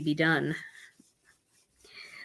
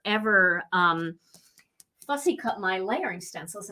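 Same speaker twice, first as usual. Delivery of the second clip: ordinary delivery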